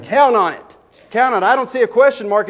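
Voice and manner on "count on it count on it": "Count" punched and dropping firmly both times; emphatic assurance. Shown as a demonstration. Preacher driving home Paul's meaning to the congregation, emphatic. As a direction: hammer "Count on it" down like a promise. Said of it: "His reckon right here is saying".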